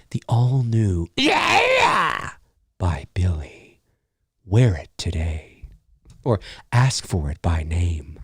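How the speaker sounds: low, slow, sultry ad voice; sensual cologne commercial. Pretend. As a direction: purr it like a perfume ad. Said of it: "A very sensual, soft sounding cologne commercial".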